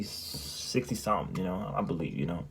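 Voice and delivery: ordinary talk, nothing marked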